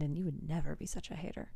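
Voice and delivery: breathy conspiratorial whisper; mock whisper. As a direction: whisper it like a secret to the listener. Aside to dear listener.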